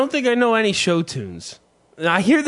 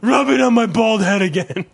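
giggly late night call; 5 semitones higher, 4 dB louder than usual. Retold or imitated. Continues mocking lovey couple.

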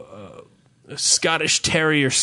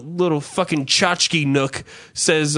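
usual speaking voice

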